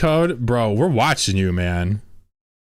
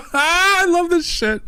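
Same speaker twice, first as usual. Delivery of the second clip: laughing; slower than usual. Real, not acted. Laughing at chat.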